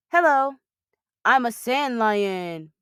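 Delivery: playful booming lion greeting; booming sand lion. Acted. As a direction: boom it out as a friendly lion. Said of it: "boomed a loud voice".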